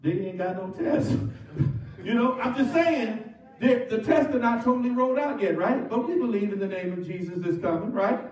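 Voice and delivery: normal talking voice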